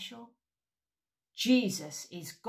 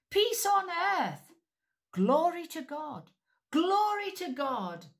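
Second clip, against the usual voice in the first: joyful shout; 10 semitones higher, 5 dB louder than usual. Shown as a demonstration. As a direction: shout it out together. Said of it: "Let's shout that".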